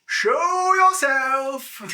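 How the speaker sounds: slow, loud booming command; booming shout. Acted. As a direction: boom it out, drawn out. GM voices dragon calling out.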